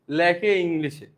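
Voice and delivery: ordinary delivery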